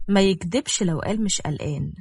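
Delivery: plain reading voice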